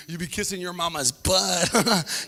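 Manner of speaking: ordinary talk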